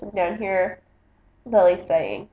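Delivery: ordinary delivery, nothing marked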